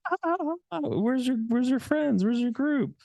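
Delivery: very high, amused mock voice; teasing women. Women teasing the stranger about his friends, very high and mocking. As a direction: imitate the women laughing at him. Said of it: "they're like".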